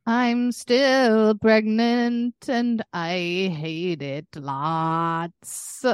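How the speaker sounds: slow, stretched complaint; dragged-out whine. Real, not acted. Telling her co-host she hates being pregnant, slow whine. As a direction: drag the words out wearily.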